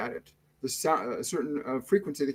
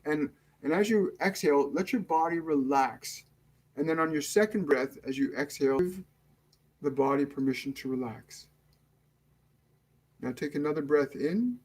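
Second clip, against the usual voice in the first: guided meditation; 4 dB quieter than usual. Acted. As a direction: ease them into calm, unhurried and hushed. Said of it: "we're going to start the meditation now".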